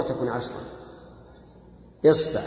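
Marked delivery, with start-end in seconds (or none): 2.1-2.4 إصبع with an i on the hamza, a on the ba; variant pronunciation isba'. Shown as a demonstration. Teacher shows students the variants, إصبع said "isba'". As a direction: say إصبع as "isba'", i first, a after the b. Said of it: "أي وجه تقرأه ما تغلط".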